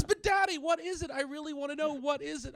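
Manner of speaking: put-on high, insistent kid voice; pestering son. Son presses dad to explain, pestering kid voice. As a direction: beg Dad for the answer like a child. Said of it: "He goes".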